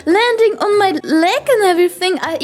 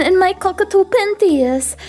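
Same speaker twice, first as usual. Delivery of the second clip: ordinary talk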